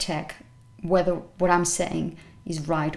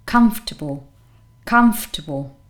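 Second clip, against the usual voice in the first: comfortable as CUMF-tuh-bul, first syllable strong; low careful comfortable, weight on COMF; stress on first syllable; 4 semitones lower, 4 dB louder than usual. Shown as a demonstration. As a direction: hit COMF in comfortable, rest weak. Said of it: "And the stress".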